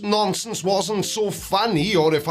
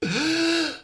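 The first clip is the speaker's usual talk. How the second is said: mock gasp; 8 semitones higher than usual. Mock surprise.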